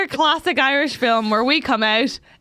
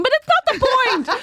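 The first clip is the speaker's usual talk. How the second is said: high-pitched laughing protest; mock indignant; 12 semitones higher than usual. Real, not acted. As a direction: object in comic outrage. Mock-indignant defence amid laughter.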